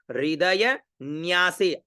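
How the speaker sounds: हृदय with री said as रि, slowly; slow careful pronunciation. Shown as a demonstration. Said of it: "इसका उच्चारण क्या हो गया?".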